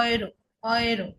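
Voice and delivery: "ইউরো" said as oy-ro; eu as "অয়". Shown as a demonstration. Teacher drilling the German eu sound, oy at the start. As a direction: begin "ইউরো" with "অয়" instead of "ইউ". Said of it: "অয়ের মতো উচ্চারণ হবে".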